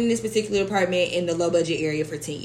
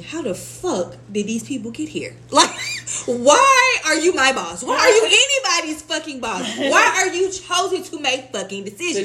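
building to a louder, higher outraged disbelief; incredulous rant; 7 semitones higher, 4 dB louder than usual. Retold or imitated. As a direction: rant at bosses who don't deserve it. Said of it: "it has me thinking".

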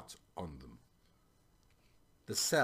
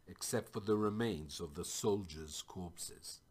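ordinary delivery, nothing marked

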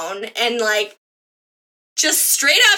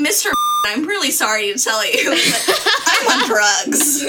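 laughing; 2 semitones higher than usual. Real, not acted. Jokey confession while laughing.

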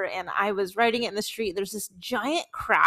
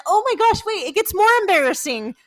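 higher and louder, bursting out; excited realization; 10 semitones higher, 6 dB louder than usual. Real, not acted. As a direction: blurt it as the memory hits. Suddenly remembering worse memory.

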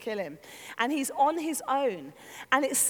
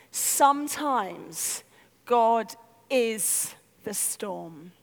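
very slow, lowered, weighty pauses; dawning realisation; 5 semitones lower, much slower than usual. Retold or imitated. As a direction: let each word land in revelation. Sudden enlightenment, slow realisation.

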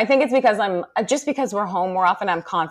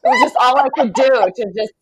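ordinary talk

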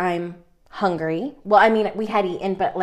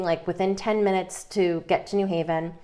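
ordinary talk

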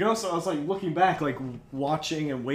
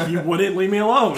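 laughing excuse; 3 semitones higher, 4 dB louder than usual. Retold or imitated. Retold excuse to the coach.

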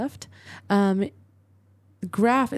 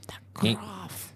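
hushed whisper, much softer; whispered correction; 7 dB quieter than usual. Real, not acted. Listener corrects the reader's "Griff", whispered prompt. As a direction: whisper the word as an aside.

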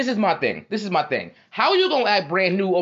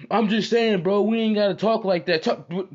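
higher-pitched imitation of a friend protesting; defensive homie; 6 semitones higher than usual. Homie backpedaling to Scotty with the woman around, imitated protest. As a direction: plead the point in a raised pitch.

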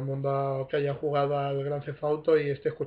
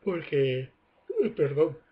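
normal talking voice

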